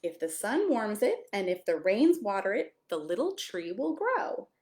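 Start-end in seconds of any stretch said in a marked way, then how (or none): none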